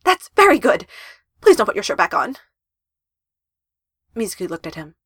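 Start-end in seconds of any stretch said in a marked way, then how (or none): 0.0-2.4 desperate plea. Acted. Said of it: "Nakatsu said desperately".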